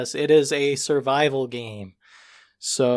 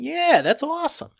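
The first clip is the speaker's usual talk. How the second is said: much higher, thrilled approval; mock excitement; 13 semitones higher than usual. Player first hears the cool idea, high-pitched mock excitement. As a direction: gush about it in a high pitch.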